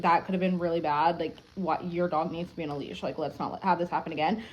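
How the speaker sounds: ordinary talk, nothing marked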